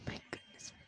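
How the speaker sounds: breathy whispered aside. Real, not acted. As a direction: whisper it under your breath. Whispered aside while playing.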